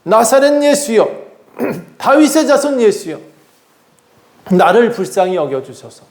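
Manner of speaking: high-pitched acted calling out, easing at the end; blind man's cry. Blind beggar calling to passing Jesus, mock cry for mercy. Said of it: "이 사람 지금 소리쳐 외칩니다".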